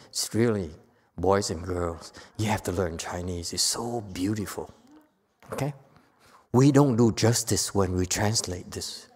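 ordinary delivery

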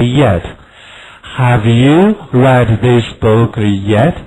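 slow, loud, evenly chanted classroom sentences; slow choral drill. Shown as a demonstration. Teacher leads the class in a slow, loud chanted drill. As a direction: lead the class through it word by word.

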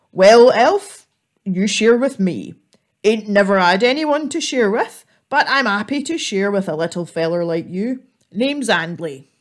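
a big lonely troll offering to share; gruff troll voice. Acted. Troll welcoming the little squirrel, loud gruff voice.

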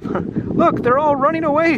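high-pitched character shout. Acted. Spike seeing the villagers flee, shouting. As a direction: call the alarm out high. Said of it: "shouted Spike".

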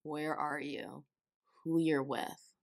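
normal talking voice

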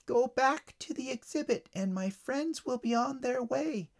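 high, tiny bug voice, slowing down; ladybug. A narrator's reading. Ladybug backed by hornets warns the boy, high little voice. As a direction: deliver a threat in a small high voice. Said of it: "said the ladybug".

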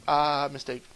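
low, soft deadpan punchline; deadpan answer. Acted. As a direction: drop low and quiet for a mock-sheepish answer. Comic answer to the name question.

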